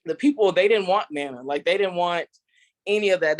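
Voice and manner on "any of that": ordinary talk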